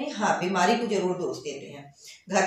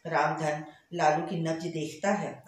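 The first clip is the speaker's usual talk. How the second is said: plain reading voice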